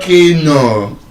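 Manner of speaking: Keno slowly as KEE-NO, both syllables full; wrong pronunciation. Shown as a demonstration. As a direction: draw out both halves of Keno. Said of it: "All of them are still wrong".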